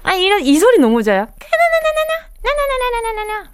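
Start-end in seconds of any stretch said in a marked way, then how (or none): 1.3-3.5 very high sing-song dolphin squeal; dolphin call imitation. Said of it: "이렇게, 이렇게".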